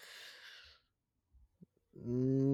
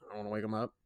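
low monotone; 3 semitones lower, 6 dB quieter, much faster than usual. Retold or imitated. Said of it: "I was very monotone and low tone".

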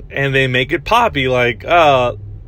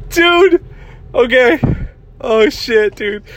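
high-pitched voice left over from laughing; cracking up; 11 semitones higher, slower than usual. Real, not acted. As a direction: try to talk through a real laughing fit. Cracking up at own story.

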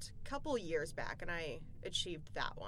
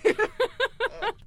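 loud, high laugh; high giggle; 13 dB louder than usual. Real, not acted. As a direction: burst out giggling. Guest laughs after greeting.

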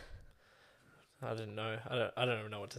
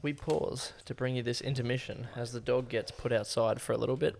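louder, playful intermission announcement; mock announcer; 5 dB louder than usual. Host filling in as dog goes outside, mock announcer voice. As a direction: announce a pretend intermission to listeners.

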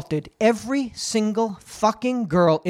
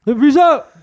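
loud, laughing mock "Peace out."; 7 semitones higher, 7 dB louder than usual. Mocking the deaf Neanderthal's goodbye, loud and laughing. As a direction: bark "Peace out." high and loud through a laugh. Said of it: "You say, "Peace out"?".

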